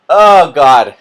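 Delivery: sudden loud "Oh, God" breaking the reading; a real, loud out-of-story exclamation; startled aside. Real, not acted. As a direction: blurt it out, caught off guard.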